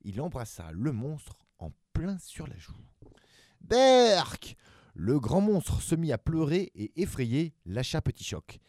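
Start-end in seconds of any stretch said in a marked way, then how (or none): 3.6-4.5 very high, loud squeal of disgust; disgusted yuck. Acted. Giant monster kissed on the cheek, squealing yuck. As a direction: recoil with a shriek.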